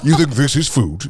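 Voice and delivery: slow, mock disgusted scoff; impression of a food snob. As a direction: sneer at the plate. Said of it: "I love your impression".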